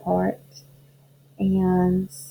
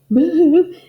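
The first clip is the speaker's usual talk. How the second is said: high loud boo; loud cry; 9 semitones higher, 7 dB louder than usual. Acted. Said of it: "who cried".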